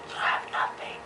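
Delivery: hushed dramatic whisper. Acted. Vlogger teasing viewers before revealing the law, whispered. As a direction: whisper it like a secret.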